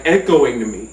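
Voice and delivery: ordinary delivery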